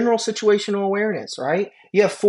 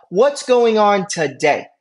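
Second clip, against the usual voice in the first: slow, drawn-out friendly greeting; slow, deliberate patient greeting; 3 semitones higher, much slower than usual. Shown as a demonstration. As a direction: stretch every word as you greet the patient. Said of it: "But I like to say".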